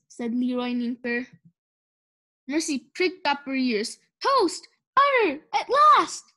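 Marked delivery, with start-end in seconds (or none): 4.2-6.3 high, loud burst of delight; overjoyed cry. A narrator's reading. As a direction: cheer like a pig spotting butter. Pig smells toast and butter.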